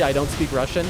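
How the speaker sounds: usual speaking voice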